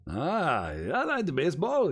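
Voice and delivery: put-on loud, high-swooping Russian accent. Stranger tells Americans he likes baseball, high loud Russian accent. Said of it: "I'm doing a Russian accent".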